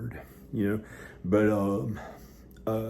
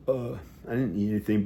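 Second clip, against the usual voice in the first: normal talking voice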